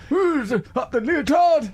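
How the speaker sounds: slow, high posh drawl; Prince Philip impression. Prince Philip teasing an acrobat, slow and high. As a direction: stretch it out like a royal cracking a joke. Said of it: "Something like that".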